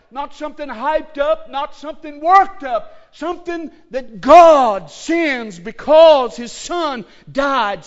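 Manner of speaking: fiery preaching, building to a shout. Real, not acted. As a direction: thunder it from the pulpit. Preaching at full force.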